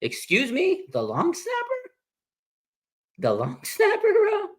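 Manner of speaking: squeaky high, incredulous with a laugh breaking in; can't believe they cut the long snapper; shocked falsetto double take; stunned disbelief. Retold or imitated. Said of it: "I was just like".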